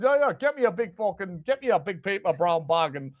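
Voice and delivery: improvised Northern manager impression; Big Sam Allardyce impression. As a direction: bluff it as an E-by-gum Northern boss. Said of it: "I don't even know if this is how he talks".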